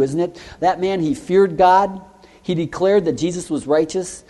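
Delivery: ordinary delivery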